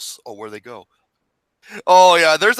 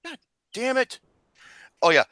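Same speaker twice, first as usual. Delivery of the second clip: normal talking voice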